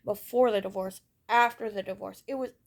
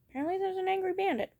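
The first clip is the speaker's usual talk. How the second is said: high cat talk; 10 semitones higher, faster than usual. Real, not acted. Talking to her cat.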